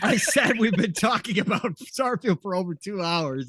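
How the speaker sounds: emphatic repeat. Real, not acted. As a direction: repeat it loudly for someone who missed it. Repeats himself after not being heard.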